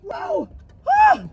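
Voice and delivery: high, drawn-out cries rising to a yelp; mock startled cries. Pranking at the drive-thru, mock startled cries. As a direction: act terrified of the horn. Repeated fake scares.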